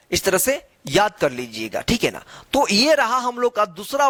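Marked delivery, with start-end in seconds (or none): none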